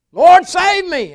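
a loud, slow, high plea to the Lord; drawn-out desperate shout for help; desperate cry. Retold or imitated. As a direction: cry out like a man sinking in the water. Crying out to God, sinking.

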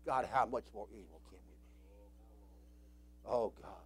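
soft, low, slow prayer; hushed anguished prayer; quiet lament. Real, not acted. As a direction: pray almost to yourself in sorrow. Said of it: "I cry".

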